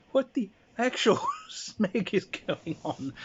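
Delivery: slow, high, laughing disbelief; baffled outburst. Retold or imitated. Party reacts to the chaos, slow, high and laughing. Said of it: "the rest of us are like".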